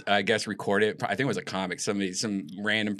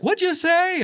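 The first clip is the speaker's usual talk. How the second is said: very high, loud mock comedian voice; Jerry Seinfeld; 5 dB louder, slower than usual. Comedian on stage can't hear the question, high loud impression. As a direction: squawk it out as an exaggerated impression. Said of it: "He's like".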